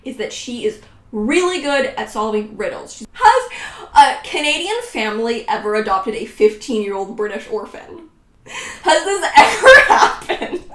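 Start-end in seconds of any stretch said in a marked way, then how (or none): 2.9-10.6 real laughter breaking through the question; laughing in disbelief. Real, not acted. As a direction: crack up mid-sentence at the absurdity. Laughing at her plot.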